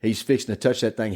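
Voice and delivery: ordinary delivery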